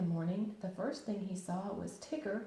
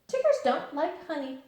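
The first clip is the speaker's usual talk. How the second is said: higher, louder, firm and final; decided Tigger; 8 semitones higher, 8 dB louder than usual. Acted. Tigger deciding about honey at breakfast. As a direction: state it with total certainty. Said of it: "in a very decided voice".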